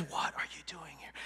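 low, very soft whispered question. Acted. God asking Elijah after showing him the fire, whispered. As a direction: hush right down to a whisper. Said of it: "he whispers to him".

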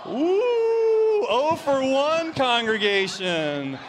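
high, loud, drawn-out teasing wail; mock dismay. Host mock-laments congregation's wrong answer.

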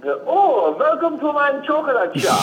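high, slow sing-song shopkeeper welcome; mock shopkeeper. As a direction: greet customers like a twee chocolatier. Mock chocolate shop owner greeting.

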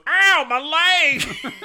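laughing mock sheriff whine; hammed-up injury cry. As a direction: overact the pain, laughing. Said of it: "And he goes".

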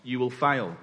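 ordinary talk, nothing marked

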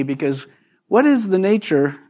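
normal talking voice

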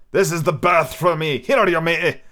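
put-on old captain crying out. Captain calling to the man with the barrow, gruff and loud. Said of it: "he cried".

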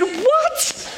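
very high, rising, laughing disbelief; baffled what. Retold or imitated. Husband hearing she meant the bag, squeaking in disbelief. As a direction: squeak out total confusion.

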